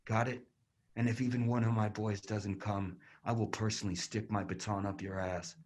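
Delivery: soft cruel menace; menacing low threat. Acted. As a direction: threaten her close to her ear. Cop threatens detained woman.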